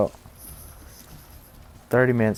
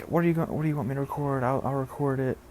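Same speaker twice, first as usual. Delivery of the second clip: high, hurried mock eagerness; eager agreement; 6 semitones higher, faster than usual. Henry agreeing to record for the boss, eagerly. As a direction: imitate someone falling over himself to help.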